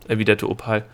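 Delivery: plain reading voice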